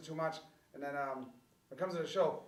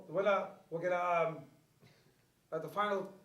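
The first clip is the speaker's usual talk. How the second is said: normal talking voice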